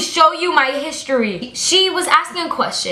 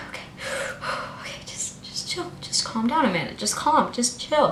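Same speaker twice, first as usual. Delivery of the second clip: self-calming; 2 semitones lower, 9 dB quieter than usual. Retold or imitated. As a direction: sigh and talk yourself down quietly. Talks self down from anger.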